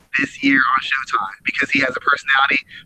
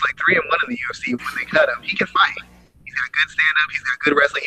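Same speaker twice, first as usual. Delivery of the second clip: ordinary delivery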